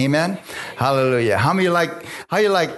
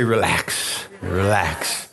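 relax; 7 semitones lower, much slower than usual. Shown as a demonstration. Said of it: "Everybody say".

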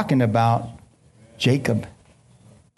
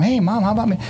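imitating himself as a kid; 8 semitones higher, much faster than usual. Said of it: "I would tell my mom".

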